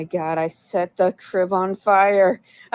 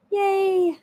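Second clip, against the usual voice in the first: cheery yay; 12 semitones higher than usual. Real, not acted. Thanking a donation.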